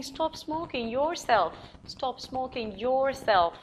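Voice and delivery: slow, weighty stop smoking yourself; slow emphatic example. Shown as a demonstration. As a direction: lean on "yourself" slowly. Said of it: "You would like to emphasize".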